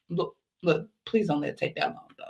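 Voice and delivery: soft, low pleading aside; hushed plea. Real, not acted. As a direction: plead under your breath. Aside pleading it won't take long.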